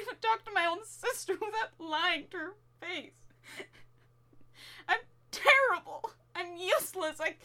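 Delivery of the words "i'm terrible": loud, higher and tearful; sobbing self-reproach; crying. Acted. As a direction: break into a sob on it. Crying over failures.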